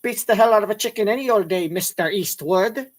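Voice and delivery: put-on a lively Irish accent; a Western-days Irishman from Back to the Future. Irish McFly in the Old West greeting Clint Eastwood, in an Irish accent. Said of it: "That's an Irish accent from".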